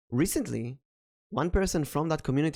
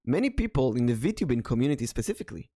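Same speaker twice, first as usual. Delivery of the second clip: normal talking voice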